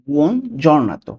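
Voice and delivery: buon giornato stretched out slowly; slow demo. Shown as a demonstration. Teacher modelling buon giornato slowly for learners. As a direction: lay out buon giornato one syllable at a time.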